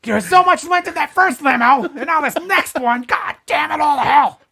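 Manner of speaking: very high, loud hammed-up rant; mock furious screaming. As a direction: scream in pretend outrage at the top of the voice. Acts out ranting about the lint.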